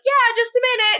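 high-pitched, falsely breezy call; calling back. Retold or imitated. Elena stalls someone outside while hiding tissues, calling back high. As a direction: imitate a high-voiced call through a door. Acted reply from behind a closed door.

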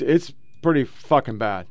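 ordinary delivery

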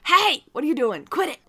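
high loud yell, like telling off a dog; scolding yell. Retold or imitated. Podcaster scolding her dog for doing something bad, high loud yell. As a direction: bark at a misbehaving dog. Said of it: "that's how I talk to my dog".

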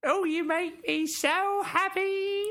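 very high, slow falsetto villager voice; squeaky game villager. Retold or imitated. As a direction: squeak it like a silly game character. Quoting Fable game voices.

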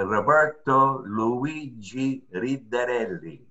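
rolled Italian R's, slow and proud; Italian accent. Shown as a demonstration. Asked for his name, says it with Italian flair. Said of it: "you want with the Italian accent?".

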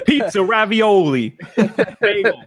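put-on New York accent, drawn out slowly; hammed-up New York deli voice; thick New York accent. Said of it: "a thick New York accent".

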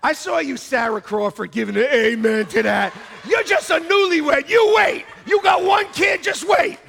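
high, loud playful shouting; mock scolding. Real, not acted. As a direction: holler a teasing warning at a newlywed. Jokingly scolds a listener's amen.

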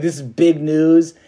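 excited angel. Retold or imitated. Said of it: "Gabriel being just so excited".

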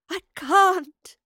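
high, loud anguished wail; weeping. A narrator's reading. Professor refusing Astrid's plea, weeping. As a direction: cry out in helpless grief. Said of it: "wept the professor".

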